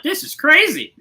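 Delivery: high-pitched retold disbelief; retold amazement. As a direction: relive your astonishment, pitch leaping up. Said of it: "And I'm like".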